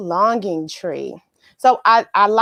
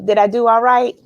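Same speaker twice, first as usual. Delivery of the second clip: ordinary talk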